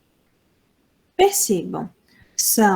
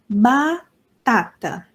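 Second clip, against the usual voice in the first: batata drawn out slowly, ba-ta-ta; teacher spelling out batata slowly; slow, syllable by syllable; much slower than usual. Shown as a demonstration. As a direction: stretch batata into its three beats slowly. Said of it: "Vejam, tem quatro sílabas".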